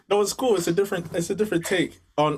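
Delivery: laughing through the words; giggling reply. Real, not acted. Laughing at host's voice.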